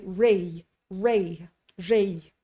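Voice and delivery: rei ending in an English ay glide; learner's drawn-out diphthong in rei; mistaken gliding vowel. Retold or imitated. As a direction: let rei slide from e to i. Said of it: "one mistake that I hear very commonly".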